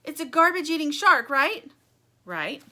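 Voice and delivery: high, loud eager explanation; explaining Stingray. Acted. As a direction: lay out the plan excitedly. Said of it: "explains Stingray".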